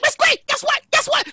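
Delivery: high, urgent mock pleading for attention; mock panicked yelling. Son keeps calling to mom, high mock panic. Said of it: "Why are you yelling for?".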